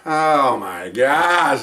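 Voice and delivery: very slow, drawn-out oh my gosh; dawning realization. Real, not acted. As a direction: let it sink in slowly. Realization reacting to deaf man.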